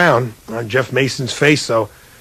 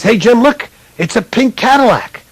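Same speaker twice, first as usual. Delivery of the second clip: much higher, gleeful call; excited pointing out; 11 semitones higher, 4 dB louder than usual. Retold or imitated. Co-host Lynn Swann pointing on center court, excited. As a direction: spot it and call out, delighted.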